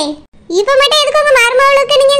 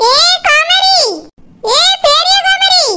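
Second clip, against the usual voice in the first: higher call to someone in another room; 5 semitones higher, slower than usual. Acted. Mother-in-law calling her daughter-in-law, sharp and high. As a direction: summon her sharply from across the house.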